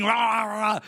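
short mock-crazed roar; faked madness. Preacher imitating David scratching and spitting, crazed roaring. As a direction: roar wordlessly, playing the madman. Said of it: "can you imagine scratching, spitting".

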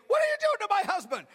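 high, loud alarmed cry. Retold or imitated. Wife cries out to the pastor in loud alarm. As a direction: cry out in alarm at what's happening.

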